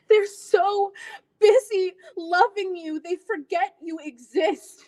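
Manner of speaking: hurt daughter breaking as she explains; tearful dramatic monologue. Acted. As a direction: let the pain rise through the pauses. Performs an audition monologue, tearful.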